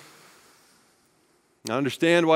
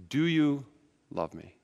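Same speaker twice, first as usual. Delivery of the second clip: gentle question; 5 dB quieter, slower than usual. Shown as a demonstration. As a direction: ask it tenderly, almost under your breath. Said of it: "He simply asks Peter".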